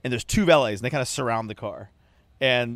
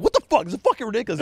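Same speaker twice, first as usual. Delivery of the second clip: higher, louder exasperated swearing; retold angry outburst; 9 semitones higher, 5 dB louder, faster than usual. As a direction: blow up at the traffic again. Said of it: "heard me be like".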